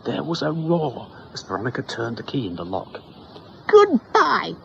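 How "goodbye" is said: high, loud defiant goodbye; girl screaming goodbye at the doctor. Acted. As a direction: yell it and storm off. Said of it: "she screamed at McCoddity".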